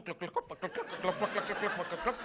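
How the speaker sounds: hoof clops. Acted. Imitating horse hooves.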